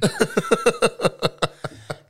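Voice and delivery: loud high laugh; high burst of laughter. Real, not acted. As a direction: cracks up loudly. Laughing at own impression.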